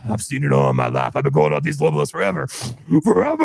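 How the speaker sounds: deep, growling Alex Jones rant; Alex Jones impression. Cohost showing off his Alex Jones voice, deep rant. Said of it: "Every time I do an Alex Jones impersonation".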